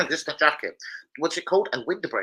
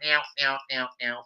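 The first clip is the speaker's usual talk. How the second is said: cat meowing; 5 dB quieter than usual. Pretend. Imitates cat mid chat.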